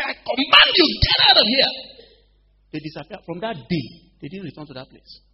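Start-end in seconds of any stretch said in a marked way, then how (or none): 0.0-1.7 acted-out high, very loud order; re-enacted rebuke at full volume; shouted command. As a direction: cast them out with a forceful yell. Re-enacts woman commanding cats away.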